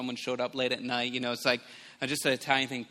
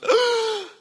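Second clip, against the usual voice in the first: loud, high gasp for air; sudden revival gasp; 8 dB louder than usual. Retold or imitated. Imitating child revived from drowning, loud sudden gasp. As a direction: gasp sharply like coming back to life. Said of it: "the child goes".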